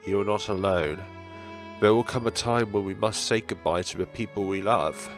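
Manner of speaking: ordinary talk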